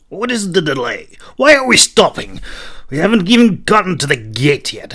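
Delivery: high, loud irritated questions; growling. A narrator's reading. Rukuth growling at the halt, loud and irritated. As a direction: snap at the delay. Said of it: "he growled".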